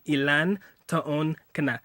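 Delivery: Ilan taon ka na spaced out slowly; slow model phrase. Shown as a demonstration. Teaching how to ask her age, slow Ilan taon ka na.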